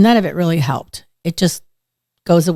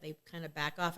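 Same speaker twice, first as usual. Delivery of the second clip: ordinary delivery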